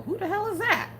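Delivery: high, baffled question; exasperated. Retold or imitated. Reacting to an unknown streamer, high and baffled. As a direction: shoot it up high in disbelief. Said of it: "I'm like".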